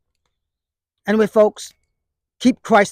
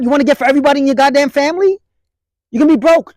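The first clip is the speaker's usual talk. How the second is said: exasperated rant; 6 semitones higher, 4 dB louder, much faster than usual. Real, not acted. Vlogger scolding viewers about holiday debt, exasperated and loud. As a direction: pitch jumps up, louder and fast.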